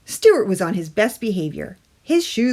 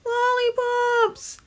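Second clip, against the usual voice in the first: high, slow squeaky tooth voice; muffled tooth voice; much slower than usual. A narrator's reading. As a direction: draw it out like a tiny hidden creature. Tooth answers again.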